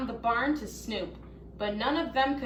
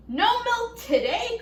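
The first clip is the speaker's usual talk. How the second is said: high, loud cry of dismay; cried out; 11 semitones higher, 5 dB louder than usual. A narrator's reading. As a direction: cry it out like an outraged farmer. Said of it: "cried Farmer Brown".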